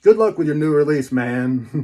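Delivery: ordinary delivery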